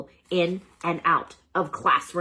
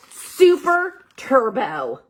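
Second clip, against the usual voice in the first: big slow superhero announcer voice, louder; heroic announcer; 7 semitones higher, 9 dB louder, much slower than usual. A narrator's reading. Narrator unveils the hamster's secret identity, booming heroic announcer. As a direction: boom it out like a trailer voice.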